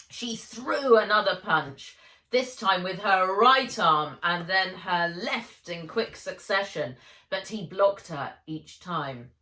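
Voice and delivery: plain reading voice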